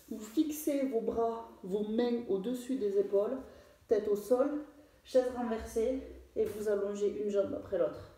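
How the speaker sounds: normal talking voice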